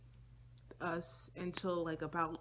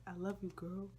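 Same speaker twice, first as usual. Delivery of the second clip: deep-voiced boyfriend; 7 dB quieter than usual. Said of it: "I can't even do it as deep as he was doing it".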